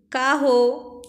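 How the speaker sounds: answers loud across the house; shouting back. A narrator's reading. Husband shouts back to his wife in the bedroom, loud reply. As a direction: call back loudly to the other room. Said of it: "इकडून अर्जुनराव ओरडले".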